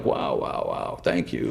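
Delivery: low, hushed wows; quietly taken aback, voice dropped right down; retold stunned reply. Answering a tearful fan, retold hushed amazement.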